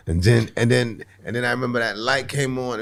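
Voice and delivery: ordinary talk, nothing marked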